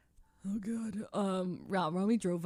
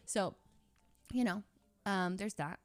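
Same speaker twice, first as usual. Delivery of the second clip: ordinary delivery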